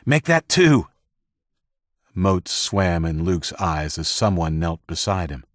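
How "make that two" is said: loud, much higher call. Acted. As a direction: shout it to the medics nearby. Rescuer calling for stretchers.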